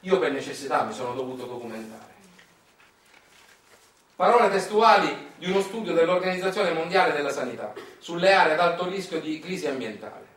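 ordinary talk

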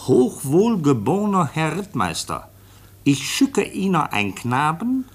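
reading a letter aloud. Acted. Officer reads the foundling's letter to the chief, slow formal reading. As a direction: read out each word slowly and carefully. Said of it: "Ich lese mal vor".

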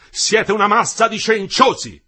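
loud, high, slow contemptuous shout; furious insult. A narrator's reading. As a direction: spit the insult at them. Engineer insulting workers, furious.

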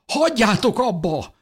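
very high, desperate cry; mother's shout. A narrator's reading. Mother breaks up the fight, shouting. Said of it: "kiáltott Mikál édesanyja".

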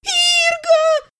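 very high, drawn-out shriek; crying out. A narrator's reading. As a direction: scream your brother's name in a thin voice. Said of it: "продолжала кричать Элиса". (отчаянный тонкий крик)

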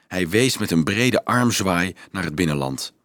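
plain reading voice

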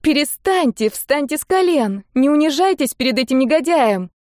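put-on high, indignant girlish protest; girl crying out. A narrator's reading. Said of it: "выкрикнула девочка". (возмущённый выкрик девочки)

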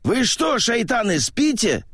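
high, hoarse angry shout; hoarse yell. Acted. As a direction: bellow at sleepy comrades. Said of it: "хрипло заорал красноармеец Велиханов".

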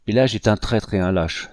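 narrator's restrained violent accusation; mildly heated denunciation; violent outcry. A narrator's reading. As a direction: accuse with a touch of vehemence. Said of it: "s'écria le capitaine Ignace avec violence".

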